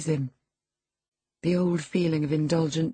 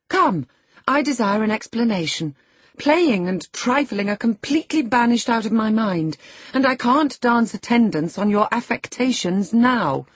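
raised, insistent scolding; impatient demand; 5 semitones higher, 5 dB louder than usual. A narrator's reading. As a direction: demand answers, out of patience. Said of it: "his cousin's patience was not sufficient to endure".

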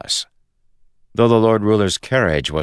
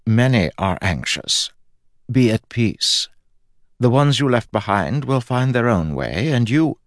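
serene, fading God; 6 semitones higher than usual. Acted. God urging the newcomer to be at peace, serene. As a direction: soothe in a higher, measured godly voice.

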